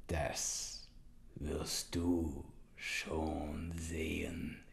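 slow menacing whisper; hissed whisper. Acted. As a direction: hiss it slowly. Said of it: "und zischte".

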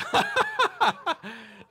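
a burst of high laughter. Real, not acted. Preacher laughing at his own age joke, high laugh.

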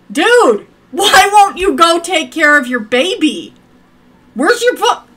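loud mock scolding of a Sim; scolding yell. Real, not acted. Yelling at Sim to parent.

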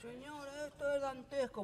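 high mock-solemn lament; bloodied groom. Bleeding groom addresses the guests, high mock-solemn. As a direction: announce it like a wounded dignitary.

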